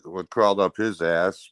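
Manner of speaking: usual speaking voice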